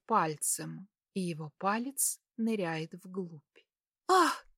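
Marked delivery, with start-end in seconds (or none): 4.1-4.5 a sharp, high "ah" of surprise; sudden high gasp. A narrator's reading. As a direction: let a gasp slip out, much higher than your voice. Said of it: "вырывается у меня".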